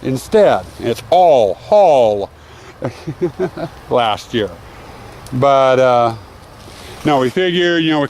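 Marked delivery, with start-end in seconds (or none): 0.8-2.3 slow, drawn-out "all haul"; emphatic HAUL. Shown as a demonstration. Vlogger setting teasers straight, slowly stressing "haul". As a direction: stretch and punch "haul" at the end. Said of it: "I'm emphasizing the word haul".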